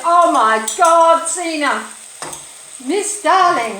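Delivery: mock outcry in an Indian accent. Hotel manager to guest, exclaiming in an Indian accent. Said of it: "that was an Indian accent there".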